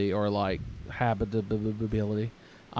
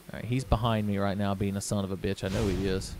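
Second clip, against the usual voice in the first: ordinary talk, nothing marked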